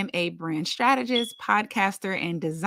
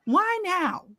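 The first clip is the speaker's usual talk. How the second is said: exasperated; 11 semitones higher than usual. Retold or imitated. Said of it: "And I'm just like".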